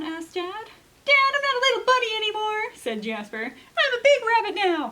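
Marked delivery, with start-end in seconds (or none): 1.0-2.7 put-on high, fast, loud little-kid voice; Jasper's kid voice. Jasper telling Dad he is big, high kid voice. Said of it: "The way I'm doing his voice". 3.4-4.9 put-on high, loud, proud kid voice with a laugh; big rabbit voice. Jasper boasting to Dad, high proud kid voice. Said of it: "He sounds like a big rabbit".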